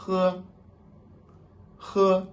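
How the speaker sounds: "He" with a light breathy h; light Chinese H. Shown as a demonstration. Teacher showing the light Chinese h to learners, airy. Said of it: "c'est un peu léger".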